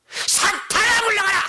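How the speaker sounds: high, loud cry to drive Satan away; shrieked rebuke of Satan; rebuking shout. Retold or imitated. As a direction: cast out the devil at full voice. Mother rebukes the demon.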